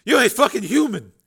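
gasped. Acted. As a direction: gasp it out in shock after being hauled up. Shaken gunman after rescue.

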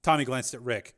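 usual speaking voice

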